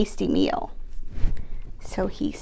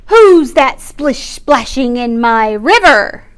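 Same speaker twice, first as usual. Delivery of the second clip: big fish voice; 10 dB louder than usual. Acted. Fish challenges troll.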